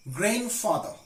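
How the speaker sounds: "Grandfather" with no d after the n; silent D in grandfather. Shown as a demonstration. Teacher shows a tricky word, "Grandfather" said without d. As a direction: say "Grandfather" as GRAN-father. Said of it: "not grandfather".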